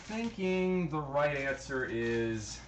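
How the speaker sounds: normal talking voice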